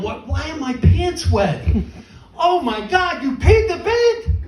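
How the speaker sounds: high, slow, stunned disbelief; shocked. Retold or imitated. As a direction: shriek it in disbelief. Shocked discovery of wet pants.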